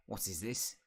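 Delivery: lightly voiced house elf; a hint of creature voice. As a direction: question it as a suspicious house elf. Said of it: "elf voice".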